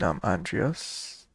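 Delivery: ordinary delivery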